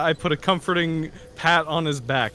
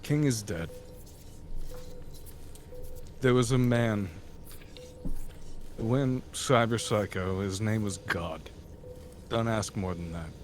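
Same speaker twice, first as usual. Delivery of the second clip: much softer, low murmur into his ear; quiet, by the ear; 7 semitones lower, 9 dB quieter than usual. Acted. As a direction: hush it close to his ear. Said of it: "by his ear".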